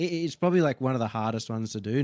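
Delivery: usual speaking voice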